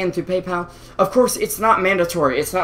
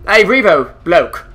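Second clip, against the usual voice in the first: loud, raised, badly faked caster voice; Toby impression; 2 semitones higher, 6 dB louder, slower than usual. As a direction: do a terrible impression of a fellow caster. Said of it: "It's the worst Toby impression ever".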